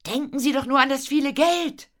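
high, loud, rushed appeal; urgent pleading. Acted. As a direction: urge her on excitedly. Clara urges Madame to accept. (drängend, hoch, laut und schnell)

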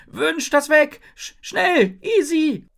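very high, loud, stuttering urgency; panicked shouting. A narrator's reading. Jin urges Isabel to wish the ice away, panicked. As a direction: shriek urgent orders in panic.

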